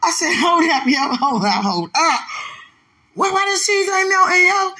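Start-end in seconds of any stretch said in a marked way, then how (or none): 0.3-2.2 high-pitched, mock-alarmed protest; retold disbelief. Stopping everyone over the cheese, retold disbelief. Retelling shock at unmelted cheese. 3.1-4.7 high, loud, incredulous question; retold bafflement. Asking why the cheese won't melt, retold bafflement.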